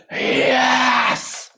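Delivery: long, high shout of triumph; ecstatic cheer. Acted. Buddhas cheering one second of meditation, ecstatic. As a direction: celebrate wildly, voice soaring.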